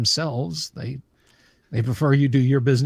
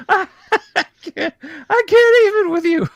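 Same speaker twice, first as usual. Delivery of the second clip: laughing it out, pitched up; cracking up at the joke; 6 dB louder than usual. Real, not acted. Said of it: "are you by any chance a robot".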